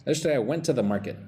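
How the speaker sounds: quick, linked delivery; fast natural speech. Shown as a demonstration. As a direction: rattle it off like a native. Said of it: "Say with me".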